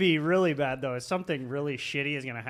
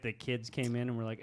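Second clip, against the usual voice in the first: usual speaking voice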